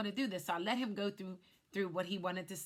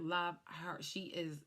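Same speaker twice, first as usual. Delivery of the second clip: usual speaking voice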